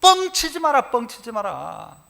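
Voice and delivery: usual speaking voice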